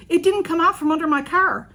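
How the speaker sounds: high, loud call from a car window; a driver calling through her open window, high and loud. Retold or imitated. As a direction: shout it across the lane.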